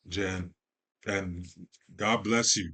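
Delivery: ordinary talk, nothing marked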